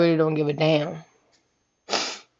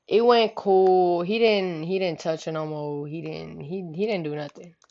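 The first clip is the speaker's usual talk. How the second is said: ordinary delivery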